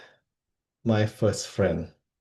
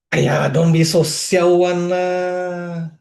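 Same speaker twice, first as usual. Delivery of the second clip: high, loud mock scolding in Singlish; Ferdinand; 8 semitones higher, 5 dB louder, slower than usual. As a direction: scold like an exasperated Singaporean, dragging it out. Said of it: "he would scold me in Hokkien".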